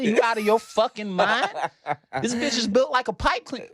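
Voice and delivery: high-pitched, outraged comic ribbing; incredulous mocking. Kid roasting a friend about his girl, high mocking voice. Acts out childhood argument about girls.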